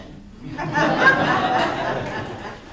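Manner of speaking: brief amused laugh; quick laugh at her own joke; short laugh. Real, not acted. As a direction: let out a quick real laugh. Laughs after joke line.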